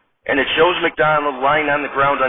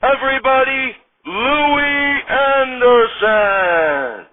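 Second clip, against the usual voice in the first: big, slow, high announcer read; 6 semitones higher, slower than usual. Acted. Announcer introducing the cast and host, slow and booming. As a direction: stretch every name like a TV show opener.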